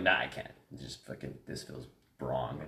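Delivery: low, soft, fading retold refusal; remorseful backing off. Retelling stopping mid-punch on his brother, remorseful. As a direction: let it drop away slowly, regretfully.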